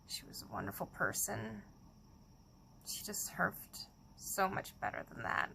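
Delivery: hushed grief. Real, not acted. Mourning her friend Phyllis, a soft grieving whisper.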